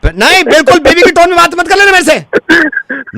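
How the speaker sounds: high-pitched indignant tirade; outraged rant. Acted. Technician threatens to rip out Wi-Fi, outraged rant. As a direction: rant as if twenty years of patience ran out. Prank technician rants at customer.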